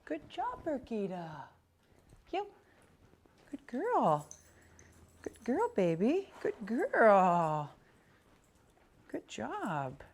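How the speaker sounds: high sing-song praise for the dog. Real, not acted. Trainer praising dog during heel.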